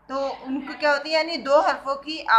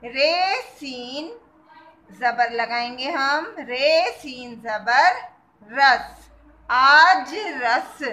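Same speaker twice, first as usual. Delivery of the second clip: each sound drawn out slowly; very slow spelling; 4 dB louder, much slower than usual. Shown as a demonstration. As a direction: spell it out for young pupils. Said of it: "ये क्या बना?".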